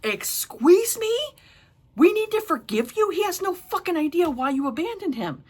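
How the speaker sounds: loud, high, incredulous "excuse me"; high-pitched, scandalized outburst; outraged retort. Acted. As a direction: fire back in indignant disbelief. Outraged retort to the book's mother.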